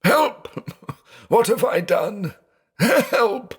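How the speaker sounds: very high, frantic call for help; panicked cry. A narrator's reading. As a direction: shriek in blind panic. Panic after breaking the plate.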